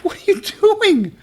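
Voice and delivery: very high falsetto mock indignation; mock protest. Boyfriend protesting girlfriend's glare, squeaky mock protest. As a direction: squeal it in exaggerated innocence, half laughing.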